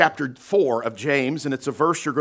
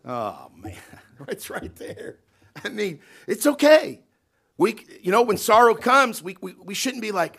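ordinary talk, nothing marked

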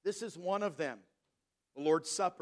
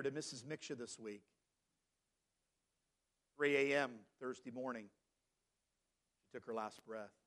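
low, soft and grave, with long pauses; hushed, solemn; 7 semitones lower, 8 dB quieter than usual. Real, not acted. Preacher telling the church of a woman's death this week, hushed and solemn. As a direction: let the voice sink for news of a death.

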